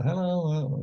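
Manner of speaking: high-pitched imitation of a northern English accent. Rocker greeting them at the club, imitated northern accent. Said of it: "in his northern accent".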